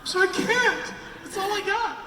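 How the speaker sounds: high, squeaky pleading; mock protest. Voice actor pleading with a director, mock protest.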